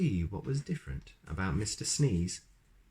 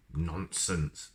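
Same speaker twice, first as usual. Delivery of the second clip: snorted; 2 semitones lower, 3 dB quieter than usual. A narrator's reading. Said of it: "snorted the wizard".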